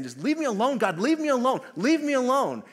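high, insistent, repeated brush-off; mock annoyed protest. People telling God to go away, high mock protest.

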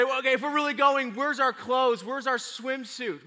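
high, urgent imitation of demanding kids; questioning kids. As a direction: press for proof like an eager child. Kids demanding proof of trip.

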